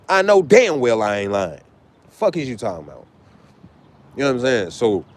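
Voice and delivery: ordinary talk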